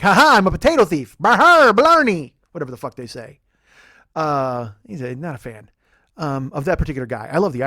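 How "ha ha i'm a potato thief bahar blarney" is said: loud, high, hammed-up Irish rogue; boastful mock-Irish cackle, rising louder; mock leprechaun. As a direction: crow it like a cartoon Irish thief. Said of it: "he's like".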